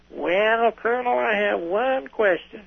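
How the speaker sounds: high, drawn-out mock British; limey British accent. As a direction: put on a high, slow limey voice for the colonel. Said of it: "he gets up like a limey accent".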